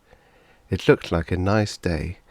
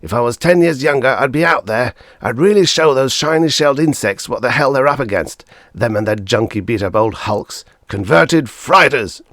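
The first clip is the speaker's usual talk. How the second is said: loud, higher, fierce boast; blazing anger; 6 semitones higher, 8 dB louder than usual. A narrator's reading. Father storming to the door, loud and fierce. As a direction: rage at the enemy. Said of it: "His eyes blazed".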